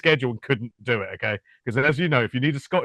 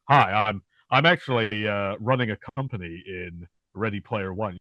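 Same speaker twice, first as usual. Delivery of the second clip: lightly mimicked American accent, pitched down; put-on American accent; 6 semitones lower than usual. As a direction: imitate a British actor doing American. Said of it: "when you hear the American accent come out of them".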